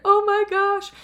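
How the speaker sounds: sudden high, loud gasp of wonder; a high, loud rush of delight at her child; overwhelmed oh my gosh. Retold or imitated. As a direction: burst out, moved almost to tears. Moved by daughter's words.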